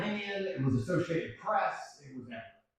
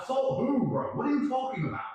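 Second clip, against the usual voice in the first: incredulous; 10 semitones higher than usual. Retold or imitated. Incredulous reply repeated.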